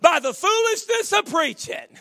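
climbing to a shouted preacher's cry; fiery preaching. Acted. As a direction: preach it out loud, building to a roar. Preaching at full force.

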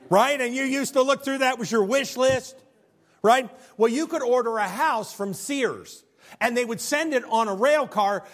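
high, loud revival preaching; rising, ringing pulpit voice; full-force preaching. Acted. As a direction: preach it out to the back row.

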